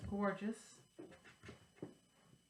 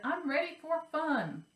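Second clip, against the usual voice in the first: excited; 8 semitones higher, 4 dB louder than usual. Acted. Pumpkin character in rhyme.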